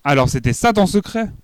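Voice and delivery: high, loud outraged cry. A narrator's reading. Child crying out at her mother over her secret. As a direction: shout it out in shock and hurt. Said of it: "Je crie".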